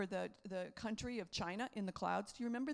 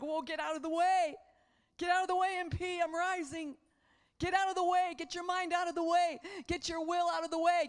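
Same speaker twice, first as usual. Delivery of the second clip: loud and high, pushing everything aside; Spirit rising, loud command; 6 semitones higher, 7 dB louder than usual. Acted. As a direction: proclaim it like the Spirit bursting up. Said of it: "it's like".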